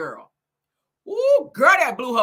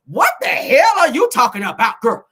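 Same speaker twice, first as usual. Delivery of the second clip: loud, higher reading of an angry comment; loud retort read-out; 3 semitones higher, 8 dB louder than usual. Retold or imitated. Reading out a rival's reply before doing her voice, indignant. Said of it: "Let me say it in her voice".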